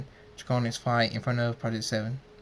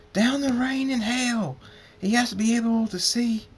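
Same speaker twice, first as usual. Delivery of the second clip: screamed; 12 semitones higher, 5 dB louder than usual. A narrator's reading. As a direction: scream it at full pitch, stretching the words. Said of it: "Draconic screams".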